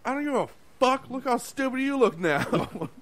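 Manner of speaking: high, loud mocking taunt, slowed down; defiant yell. Cook taunting the tea-soaked chef, loud and defiant. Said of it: "He was like".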